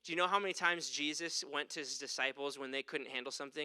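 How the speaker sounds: ordinary talk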